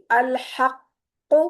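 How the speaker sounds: अल हक़ very slow, qaf heavy, doubled and bounced; heavy qaf with qalqalah. Shown as a demonstration. Teacher modelling the three rules on one qaf. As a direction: draw out अल हक़ and let the heavy qaf echo. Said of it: "काफ को पुर भी करेंगे".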